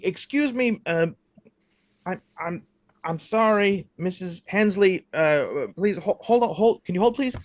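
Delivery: flustered night clerk. Acted.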